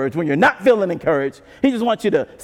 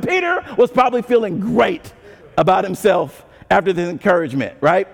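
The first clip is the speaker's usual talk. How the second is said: ordinary delivery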